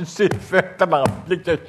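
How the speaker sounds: high, loud mock grumbling through a laugh; mocked Québécois spectators. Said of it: "les trois Québécois qui vont passer par Genève qui vont dire".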